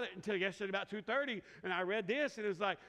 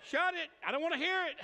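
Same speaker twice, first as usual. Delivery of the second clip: louder and higher playful shut-down; mock snap; 7 semitones higher, 4 dB louder than usual. Preacher telling his wife to hush, mock loud. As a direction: cut her off in mock protest. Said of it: "I'm like".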